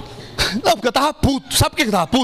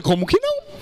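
high, eager jump in pitch; "Como que não?" thrown up high with excitement; eager exclamation; 10 semitones higher than usual. Acted. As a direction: leap at the offer, voice shooting up. Eager reply to her offer.

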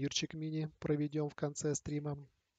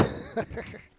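breathy laugh; 7 semitones higher than usual. Real, not acted. Laughs after betrayal. (тихий смешок)

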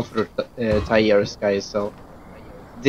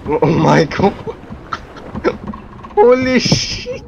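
shocked disbelief; 7 semitones higher than usual. Real, not acted. Shocked at result.

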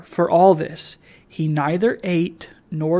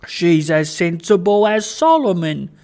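louder, delighted character voice; delighted praise; 2 semitones higher, 6 dB louder than usual. Acted. Old man praising the child, delighted. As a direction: proclaim it with proud relish.

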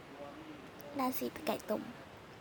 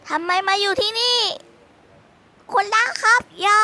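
much higher, loud panicked yell; alarmed shout; 7 semitones higher, 10 dB louder than usual. Acted. Boy yells to stop the aunt grabbing the poster, panicked loud shout.

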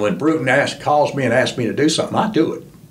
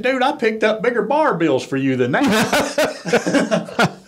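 slow, much higher, mock-indignant; retold comeback; 10 semitones higher, slower than usual. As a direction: drag out the put-down to the boss. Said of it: "I said".

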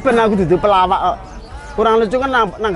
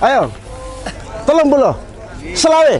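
hawker price call; 6 semitones higher than usual. Real, not acted. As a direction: shout the bargain to the crowd. Vendor calling out prices. (nawakake rega banter lan dhuwur)

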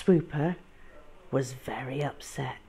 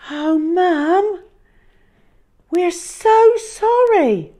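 high, loud, slow apology; sorry child; 14 dB louder, slower than usual. Acted. Hal apologizing to his mum, high and slow. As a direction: plead your sorry. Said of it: "said Hal".

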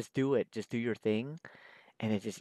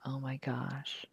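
awed whisper; 2 semitones higher, 7 dB quieter, slower than usual. Real, not acted. Amazed at own drawing.

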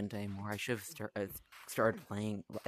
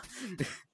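embarrassed laugh; 11 semitones higher than usual. Real, not acted. Embarrassed laugh after slip.

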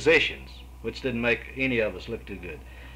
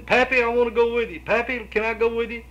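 higher-pitched imitation of a small boy pleading; little boy begging; 11 semitones higher, 5 dB louder than usual. Grandfather imitating his small son begging Pappy to come along, lightly voiced child. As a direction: mimic little Taylor begging to tag along. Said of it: "little Taylor was running around saying".

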